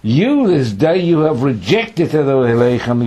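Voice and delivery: loud, slow accusation with "you" punched; emphatic you. Acted. God accusing Israel of rejection, "you" emphatic. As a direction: hit "you" high and hard. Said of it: "again emphasis".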